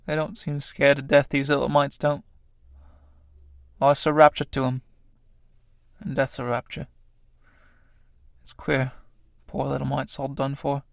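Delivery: soft, spaced-out musing; hushed mourning. Acted. Tramp kneeling by dead Chrysalis, hushed pity. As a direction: murmur it softly over the dead.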